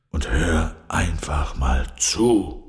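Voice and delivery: low, slow lion voice; calming lion. A narrator's reading. Lion asks Carla to simply listen, low and slow. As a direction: settle into a low, slow instruction.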